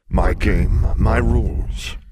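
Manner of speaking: loud, slowing monster voice; big beast, emphatic; domineering sphinx. Acted. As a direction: lay down the law, stretching the end. Sphinx asserts his rules.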